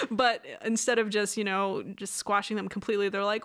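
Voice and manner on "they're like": usual speaking voice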